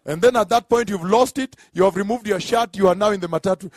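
loud, punchy pulpit delivery; forceful comic preaching. Acted. Preacher joking to congregation about turning the cheek, forceful preaching. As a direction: drive it home to the congregation, loudly.

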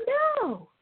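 emphatic no. Real, not acted. Emphatic rejection of her own idea.

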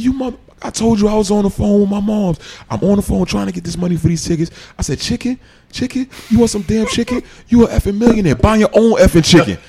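high, heated rant at the veteran; heated yelling. Retold or imitated. Rookie telling off the millionaire veteran, high heated yelling. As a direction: tear into him, mocking his demand.

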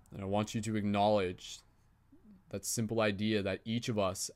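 soft, even meditation voice; guided meditation. Acted. Facilitator guiding the group circle in calm meditation voice. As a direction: gently guide the circle inward.

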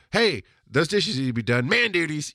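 mock wifely order, rising to a shout; playful nagging. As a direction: call out the chore in jest, punching the end. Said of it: "she'll use it for fun".

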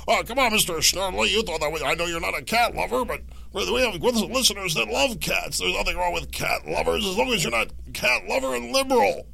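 mock-cajole the producer on air; Rush Limbaugh parody. Talk-radio host ribbing his producer Mister Snerdley, hammed-up and high. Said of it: "doing a comedic parody of the legend".

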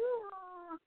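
high, soft imitated sob; mock crying sob. Describing Hall of Fame speeches, a high teary mock whimper. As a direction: mimic a teary moan at the speeches. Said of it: "It's like".

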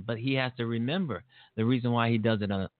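ordinary talk

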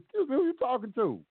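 high, pointed challenge; defiant comeback. Retold or imitated. Firing back at the judge in court. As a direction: say it just like that, daring him. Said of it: "I said it just like that".

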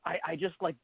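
usual speaking voice

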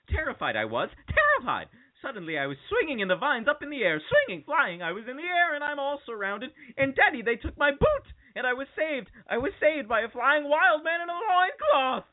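a hint of an excited girl telling daddy; Jane monologue. Girl telling daddy about the monkeys, rising excitement. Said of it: "Jane says".

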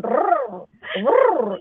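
playful mock dragon growl; huge fiery dragon roar. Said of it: "I'm just going".

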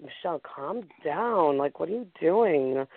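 normal talking voice

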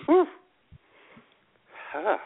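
ordinary talk

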